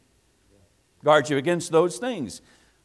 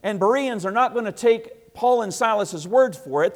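usual speaking voice

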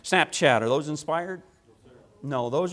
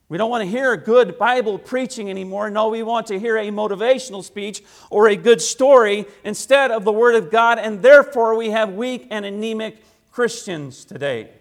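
louder, higher, rising to a pulpit cry; full-force preaching; 6 semitones higher, 7 dB louder than usual. Acted. Pastor rebukes the church for wanting stories, preaching at full force. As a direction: preach it hard to the congregation.

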